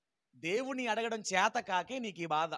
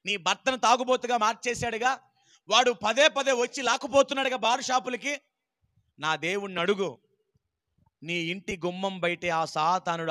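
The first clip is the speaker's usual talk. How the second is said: swelling preacher, louder at the peak; preaching; 4 dB louder than usual. Real, not acted. Preacher promising Satan stops at the door, rising fervor. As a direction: build from hush to a declaration.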